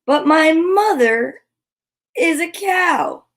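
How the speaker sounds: high, slow pleading cow; cow insisting. A narrator's reading. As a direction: stress mother, a little hurt. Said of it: "said the cow".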